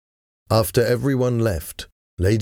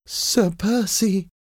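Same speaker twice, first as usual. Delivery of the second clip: high-pitched, drawn-out exclamation; astonished cry; 13 semitones higher, slower than usual. A narrator's reading. Wife finding her husband on the ground, astonished cry. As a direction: cry the name out high and long. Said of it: "she cried".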